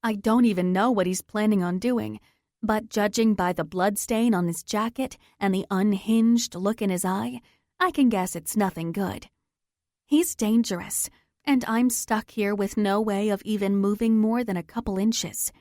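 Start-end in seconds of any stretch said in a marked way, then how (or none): none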